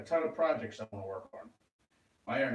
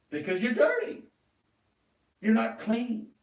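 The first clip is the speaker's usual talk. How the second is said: higher and louder, a sudden burst; loud outburst; 8 semitones higher, 4 dB louder than usual. Real, not acted. Ranting at the people being called dirty, loud and high. Mocking the accusers' contempt.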